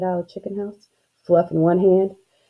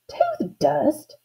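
mild shout; 8 semitones higher than usual. A narrator's reading. Girl echoes Dada in disbelief, mild shout. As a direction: exclaim it high, a touch of a shout. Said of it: "I kind of shouted".

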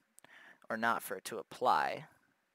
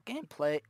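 voice crack; 11 semitones higher, 8 dB louder than usual. Real, not acted. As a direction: voice cracks sharply upward. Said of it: "pardon that voice crack".

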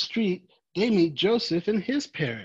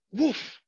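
short high playful woof; cheerful puppy woof; dog bark; 9 semitones higher than usual. Acted. Dog Manny barks.

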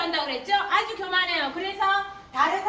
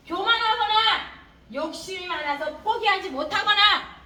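ordinary talk, nothing marked

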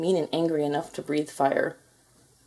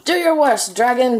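loud, high challenge; a knight calling out a dragon; bold declaration; 6 semitones higher, 6 dB louder than usual. A narrator's reading. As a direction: throw the dare at the dragon. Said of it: "declared the shiny man".

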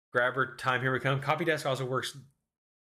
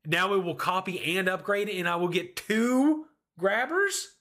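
amazed; 6 semitones higher than usual. Real, not acted. Amazed at game mechanic.

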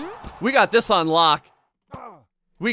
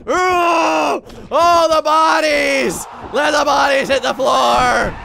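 slow, drawn-out holler; exultant shouting; 4 semitones higher, 3 dB louder, slower than usual. Real, not acted. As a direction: revel loudly in the chaos. Revels in flying bodies.

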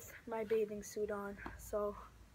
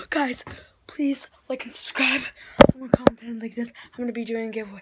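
shouting; 18 dB louder, faster than usual. Real, not acted. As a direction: yell it out, still freezing. Shouting after freezing snow angels.

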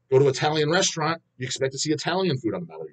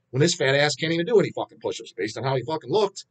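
usual speaking voice

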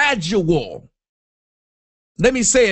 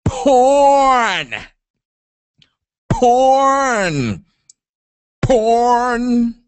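said hard; much slower than usual. Shown as a demonstration. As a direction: punch each porn out hard. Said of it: "You gotta say it hard".

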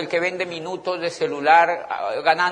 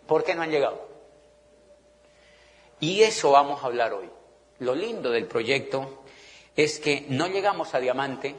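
ordinary talk, nothing marked

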